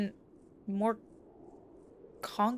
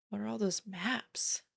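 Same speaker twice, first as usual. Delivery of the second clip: whispering; 8 dB quieter than usual. Acted. Whispered question amid menace.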